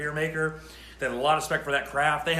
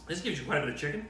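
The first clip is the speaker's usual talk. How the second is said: ordinary talk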